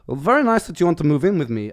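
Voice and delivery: a light, hammed-up vague European accent. Flatmate-to-be welcomes him in a vague European accent. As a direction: put on a loose European accent, half-joking. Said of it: "some vague European accent".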